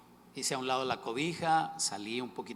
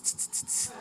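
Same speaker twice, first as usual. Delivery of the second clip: hissing tss; slower than usual. Retold or imitated. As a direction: hiss tss tss like a tap on the shoulder. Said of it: "no habría sido un tss".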